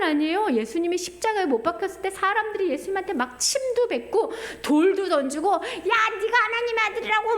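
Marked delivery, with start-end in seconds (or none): 5.5-7.4 high, sneering taunt; mocking jeer. Crowd mocking Jesus on the cross, high sneer. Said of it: "막 조롱했잖아요".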